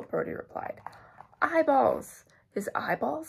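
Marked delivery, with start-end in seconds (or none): 1.4-2.2 high, loud cry of alarm; storybook shriek of discovery; alarmed exclamation. A narrator's reading. Acted alarmed discovery in soup. 2.5-3.3 higher-pitched, questioning echo; acted rising question of alarm; horrified question. A narrator's reading.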